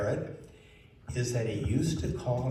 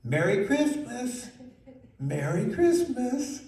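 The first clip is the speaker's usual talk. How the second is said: high, slow drilling voice; teaching the bird; 11 semitones higher, 4 dB louder than usual. Retold or imitated. Girl teaching the macaw its Christmas words, high. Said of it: "I wanna teach the macaw to say".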